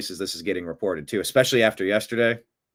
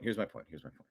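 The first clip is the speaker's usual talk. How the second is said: mock echo fade; 6 semitones lower, 15 dB quieter, faster than usual. Repeats phrase as mock echo.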